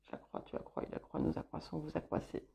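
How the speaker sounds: quick private whispered check, nearly silent; whispered conjugation. Real, not acted. Card reader checking her French conjugation aloud, whispering. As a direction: whisper the verb forms to yourself. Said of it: "parfois, je peux avoir des doutes".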